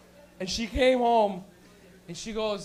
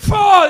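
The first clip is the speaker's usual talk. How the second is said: mock smoke blast; 10 semitones higher, 18 dB louder than usual. Smoke blowing back sound.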